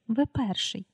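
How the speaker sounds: softly voiced, understated stage whisper; hushed reply. A narrator's reading. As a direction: read it as a mild, hushed aside. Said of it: "відповів йому пошепки Пітер".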